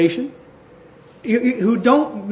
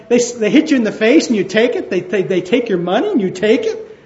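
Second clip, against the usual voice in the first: ordinary delivery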